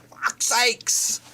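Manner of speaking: whispered exasperated curse; exasperated hiss. Real, not acted. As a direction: spit it out under your breath in exasperation. Exasperated after the outburst.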